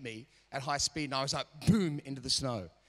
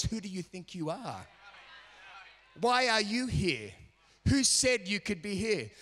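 higher, louder, slower taunting voice; voice of the enemy; 5 semitones higher, 3 dB louder than usual. Retold or imitated. The enemy mocking a climber, slow taunting sneer. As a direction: sneer it like the devil challenging you. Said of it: "there's a voice".

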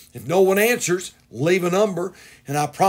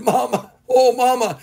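higher-pitched imitation of a boy; pleading little boy; 6 semitones higher than usual. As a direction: imitate a child pleading with mama. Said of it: "And Johnny said".

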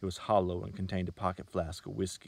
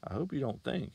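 higher, lofty indignant tone; 7 semitones higher than usual. A narrator's reading. Salesman answering the girl, lofty and indignant. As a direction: look down on her, offended. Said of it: "in a lofty, indignant tone".

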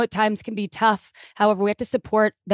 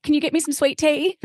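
put-on a light, pitched-up Southern accent asking for tea; 7 semitones higher than usual. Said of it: "I love your Southern accent".

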